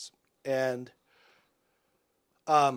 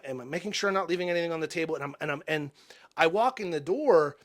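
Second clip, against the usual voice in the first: normal talking voice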